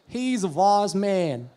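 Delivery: slow, stretched Alabama drawl; Alabama accent. Shown as a demonstration. Comedian demonstrating Southern speech to audience, Alabama accent. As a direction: draw it out in Southern Alabama English. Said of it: "called the Alabama accent".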